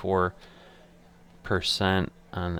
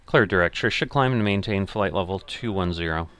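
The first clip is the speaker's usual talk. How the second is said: pilot radio readback; 5 dB louder than usual. Acted.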